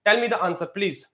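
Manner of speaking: ordinary delivery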